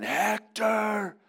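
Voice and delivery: high, sustained challenging yell; acted warrior's shout, pitched very high; Achilles. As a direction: yell the name up at the walls, stretching it out. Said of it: "and he yells again".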